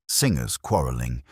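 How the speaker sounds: ordinary delivery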